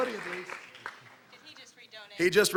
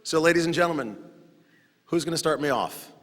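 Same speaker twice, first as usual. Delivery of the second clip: usual speaking voice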